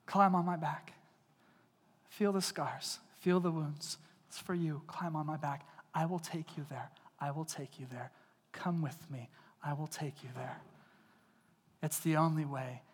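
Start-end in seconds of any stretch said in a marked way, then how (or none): 0.0-10.6 soft, hushed, reassuring; Jesus quietly inviting the weary to be carried; tender invitation. Retold or imitated. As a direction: coax them gently onto your back. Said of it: "And Jesus says".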